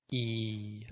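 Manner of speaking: E as the long English ee; long ee vowel. Shown as a demonstration. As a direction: say E like the letter E. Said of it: "like the letter E in English".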